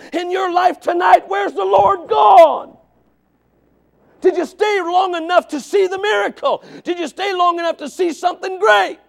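high, shouted challenge to the congregation; impassioned pulpit cry, very loud; full-force preaching. Real, not acted. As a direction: drive each question home at a shout.

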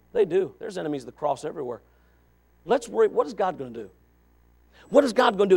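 normal talking voice

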